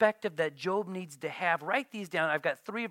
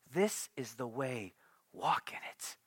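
gentle whisper of God; soft guiding voice; 4 semitones lower, 6 dB quieter than usual. Acted. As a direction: murmur it from behind. Voice behind you, God's.

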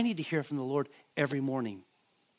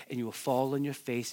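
ordinary talk, nothing marked

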